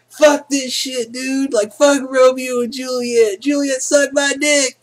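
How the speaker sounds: squeaky taunting class clown; bratty teen mock. Student heckling his teacher in class, high bratty taunt. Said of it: "And I'm like".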